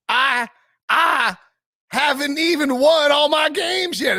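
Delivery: high, drawn-out fake Southern drawl; mock Southern accent. Imitating the coach addressing fans, hammed-up Southern accent. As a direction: exaggerate a slow, high-pitched Southern accent. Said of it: "He sounded like, uh, Foghorn Leghorn".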